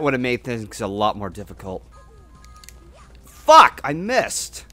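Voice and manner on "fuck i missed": angry shout. Real, not acted. Missed a jump in game.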